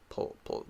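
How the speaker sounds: "pull" whispered fast with no voice; whispered syllable. Shown as a demonstration. Teacher isolating the fast final syllable, whispering "pull". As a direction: breathe out a quick "pull, pull". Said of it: "that syllable is a very fast syllable".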